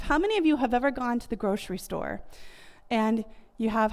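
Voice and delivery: ordinary talk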